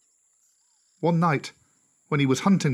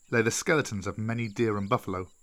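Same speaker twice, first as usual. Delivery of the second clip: ordinary delivery, nothing marked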